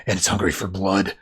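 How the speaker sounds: low, soft mock menace; retold menace. Harley threatens the family with an axe, quietly menacing. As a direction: drop right down for a hammed-up threat.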